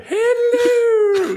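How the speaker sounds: put-on horrible parody of a royal English accent; very high, loud, hooting posh British greeting; shrieked-up mock royal British accent; the Queen. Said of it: "horrible parody of the Queen's accent".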